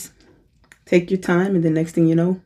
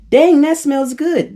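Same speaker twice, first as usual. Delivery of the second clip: delighted exclaim; 11 semitones higher, 4 dB louder, slower than usual. Retold or imitated. Example of enjoying a smell.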